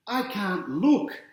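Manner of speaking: high, slow, nervous sister voice; drawn out as if hiding her eyes; scared sister. A narrator's reading. Big sister near the end of a close game, high and anxious. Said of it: "says the big sister".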